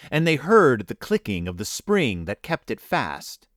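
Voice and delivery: ordinary delivery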